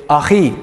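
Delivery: achi said ah-khee, throaty kh, stress on the end; guttural ah-KHEE for my brother; Hebrew achi. Shown as a demonstration. Teacher shows the class how to say achi, clear and throaty. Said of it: "you say".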